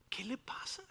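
incredulous whispered aside. Real, not acted. Preacher asks the congregation about the ungrateful servant, whispered. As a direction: breathe it in disbelief.

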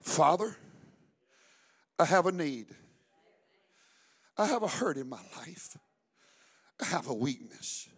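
soft, simple, heartfelt prayer; sincere prayer. Shown as a demonstration. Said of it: "Praying is talking to your heavenly Father".

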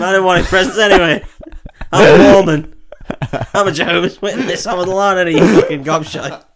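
high, laughing put-on rant; mock ranting believer. A devout kid denied presents, high and laughing.

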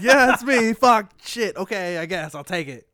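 mock exasperation, loud then easing off; hammed-up resigned grumble; grudging acceptance. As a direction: act out reluctantly owning a bad label. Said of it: "accepts the fact that he's".

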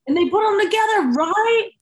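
wide-eyed excitement; amazed echo. Real, not acted. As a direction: echo it back in delight. Echoing amazed at statues.

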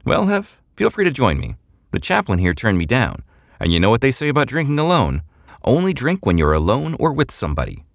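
tipsy spaceship pilot inviting a friend to drink; Eliza's voice. A narrator's reading. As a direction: pitch up and sound playful and loose.

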